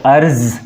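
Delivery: अर्ज ending in a z sound, with the nukta; अर्ज with z as in zoo; arz with z. Shown as a demonstration. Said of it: "लेकिन जब कहते हैं".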